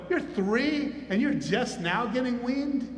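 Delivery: drawn-out, singsong mockery; mocking. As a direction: imitate an older brother teasing a toddler. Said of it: "Maybe he was mocking him".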